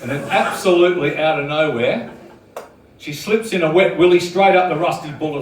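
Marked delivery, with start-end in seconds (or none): none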